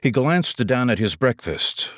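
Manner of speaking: plain reading voice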